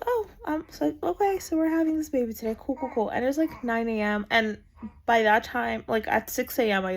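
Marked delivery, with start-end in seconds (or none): 0.1-3.1 higher, quick fake-casual cool; mock nonchalance. Mom realizing she's having the baby, fake calm. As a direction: play it cool in denial.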